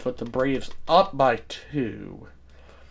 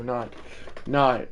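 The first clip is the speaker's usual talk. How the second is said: ordinary talk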